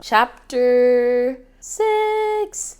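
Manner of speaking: slow, drawn-out announcement of the chapter; slow, grand chapter announcement. Acted. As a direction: announce the chapter like a title card.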